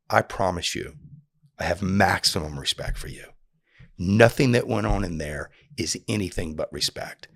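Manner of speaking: low, quiet, sincere promise; earnest reassurance. Retold or imitated. Telling an offended man face to face, earnest and hushed. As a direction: reassure him softly and low, dead serious.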